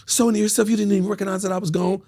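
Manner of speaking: high, quick reproachful woman; high mock girlfriend complaint; imitating woman friend. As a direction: scold him in her high voice. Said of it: "She said".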